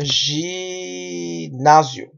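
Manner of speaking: "ginasio" drawn out slowly with a zh start; slow zheee-NAH-zyo for "ginasio"; Portuguese pronunciation. Shown as a demonstration. Teacher introduces the Portuguese word, slow Portuguese pronunciation. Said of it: "Decimos en portugués ginasio".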